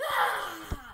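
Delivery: sudden loud scream; panicked scream. Acted. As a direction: shriek in alarm. Panics about monkeypox.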